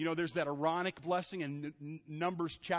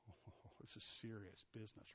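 breathy-soft, much softer, lower; hushed awe; 7 semitones lower, 17 dB quieter than usual. Retold or imitated. Wedding guests reacting, hushed in awe. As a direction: murmur it as if struck by awe. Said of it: "everybody goes".

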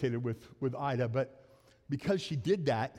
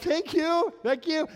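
much higher, eager mock thanks; grateful thanks; 11 semitones higher, 5 dB louder, slower than usual. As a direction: squeak out a thankful thank you. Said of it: "people were like".

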